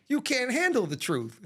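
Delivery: mock dramatic movie line; movie quote. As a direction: deliver it like a courtroom showdown. Movie line quoted, then "just kidding.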